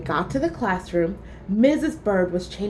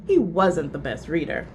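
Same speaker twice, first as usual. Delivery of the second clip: plain reading voice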